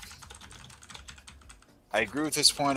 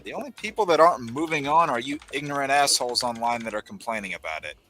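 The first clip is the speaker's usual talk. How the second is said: ordinary talk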